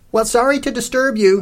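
high, sing-out apology; polite apology. A narrator's reading. Cece taking leave of the stout lady, polite. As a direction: excuse yourself cheerily, pitched well up.

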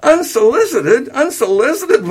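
very high, slow, outraged echo; gasping disbelief. A narrator's reading. Son throws mother's word back, gasping in disbelief. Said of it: "William gasped".

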